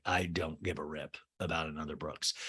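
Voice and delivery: lightly voiced kid shrugging off meeting another Brooks; bored kid. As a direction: drop the voice down and mumble, a hint of a sulky kid.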